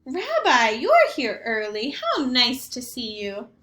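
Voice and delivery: a cheerful, half-deaf grandmother greeting her guest; Baba Brena. Acted. Grandmother greeting the rabbi at her door, chirpy old lady voice.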